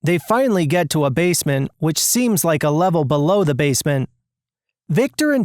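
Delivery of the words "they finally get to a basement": plain reading voice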